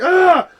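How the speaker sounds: sudden high, loud scream of fright; startled scream. Retold or imitated. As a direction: shriek as if a spider jumped at you. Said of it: "I was like".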